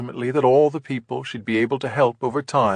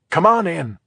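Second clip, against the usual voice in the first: brighter, higher welcoming voice for the hostess; lightly voiced agency head waving guests in; 6 semitones higher, 5 dB louder than usual. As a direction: usher them in with a lift. Host invites guests inside.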